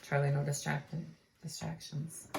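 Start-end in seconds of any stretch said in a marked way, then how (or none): none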